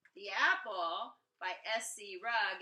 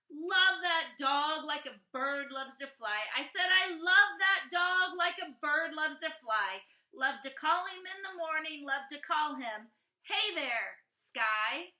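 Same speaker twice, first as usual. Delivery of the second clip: put-on louder, sing-song reading of a child's poem; 6 semitones higher, 8 dB louder than usual. A narrator's reading. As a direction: read it like a proud little poet, with a beat. Reads child's poem aloud.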